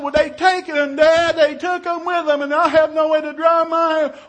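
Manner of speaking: high, loud, hammed-up whine; wailing daughter. Daughter with no way to dry her hair, wailing. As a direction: imitate a kid protesting in distress.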